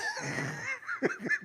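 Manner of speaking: a burst of high-pitched laughter; laughing at her own words. Real, not acted. Laughing at her own story, high laugh.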